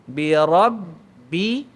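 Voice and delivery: Bi rabbi very slowly with the b held back. Shown as a demonstration. Teacher repeats rabbi with the held syaddah. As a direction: trap the sound on the doubled b. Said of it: "suara dia macam tertahan".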